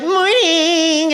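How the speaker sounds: high, sing-out mom hello; chirpy mom greeting. Retold or imitated. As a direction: trill it like an overfriendly parent. Overfriendly mom greets at playground.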